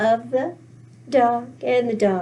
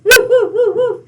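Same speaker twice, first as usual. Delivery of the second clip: high, loud playful woofs; barking dog; 9 semitones higher, 8 dB louder than usual. Acted. Eager dog answering the little girl's call, loud high barking.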